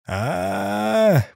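high held announcer's call; drawn-out announcer cry. Acted. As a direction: hold the call like a ringmaster.

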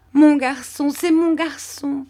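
high, slowing joyful cry; overjoyed mother. A narrator's reading. Mère Barberin holds Rémi, crying out with joy. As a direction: hold him and cry it out happily. Mother embraces returned son.

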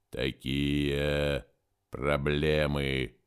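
slow, low and measured, otherworldly; slow alien voice. Acted. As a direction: speak as an ancient forest mind. Forest entity speaks slowly.

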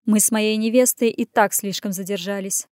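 ordinary delivery

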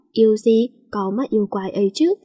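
usual speaking voice

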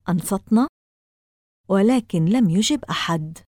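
ordinary delivery

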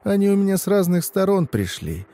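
high-pitched folksy crone; old woman. Acted. Old woman warning the driver about his hands, high crone voice. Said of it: "Она мой взгляд заметила и говорит".

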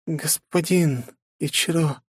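breathed out. A narrator's reading. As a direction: exhale the name. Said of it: "выдохнула она".

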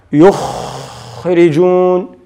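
يخرجون with a long rasping kh held mid-word; stretched khaa. Shown as a demonstration. Teacher showing khaa extends like seen, long kh in يخرجون. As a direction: drag out the خ in يخرجون. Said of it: "إذن قابل للتمديد".

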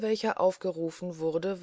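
ordinary delivery, nothing marked

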